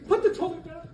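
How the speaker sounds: high, loud laughing yell; exasperated realtor yelling put the toilet back; laughing mock shout. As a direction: shout it through laughter, hammed up. Continues retold shouting at sellers.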